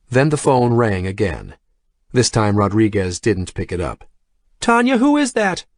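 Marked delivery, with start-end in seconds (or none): 4.6-5.6 high, calling across the office. A narrator's reading. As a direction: call out down the hall. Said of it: "she called out".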